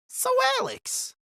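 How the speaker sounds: very high, loud character voice; pressing visitor. A narrator's reading. Two men pressing Alex for his answer, high and loud. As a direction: call him out in a high-pitched challenge.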